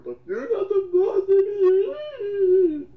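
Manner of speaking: high, slow, loud crying whine; hammed-up whiny baby voice; mock whine. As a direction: wail it like a sulking child. Mocking the critic's whiny line.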